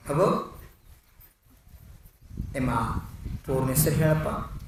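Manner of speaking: soft, tender questioning; loving. A narrator's reading. Principal gently asks a silent child his name, lovingly. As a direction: coax the child gently and quietly. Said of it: "ತಲೆ ಮ್ಯಾಗ ಕೈ ಹಚ್ಚಿ ಪ್ರೇಮದಿಂದ".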